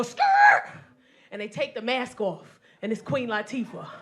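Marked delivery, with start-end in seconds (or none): 0.0-0.6 high shouted skid noise; tire skid. Retold or imitated. Trailer bike skidding to a stop, high shouted skid. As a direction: squeal it like brakes. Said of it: "they go".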